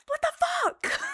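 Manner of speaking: high yelled curse at the stranger; retold shout. To the old man who shoved her, a high yell. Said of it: "I, like, kinda screamed at him".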